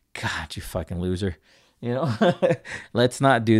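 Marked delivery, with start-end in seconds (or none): 0.1-1.4 low, muted self-reproach; self-berating. Retold or imitated. Host imitating how they constantly talk to themselves, self-berating. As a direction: scold yourself under your voice. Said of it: "constantly just telling myself".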